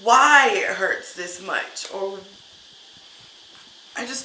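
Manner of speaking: real tears, pushing the words out slowly; hurt and angry crying, can't hold it back; crying while talking. Real, not acted. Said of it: "I'm so mad I'm crying".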